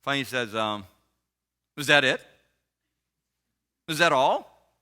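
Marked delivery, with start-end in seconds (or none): 1.8-4.7 acted disbelief. Fiancé to her after the confession, loud mock disbelief. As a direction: mimic a baffled man, punching each question.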